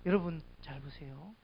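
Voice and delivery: ordinary delivery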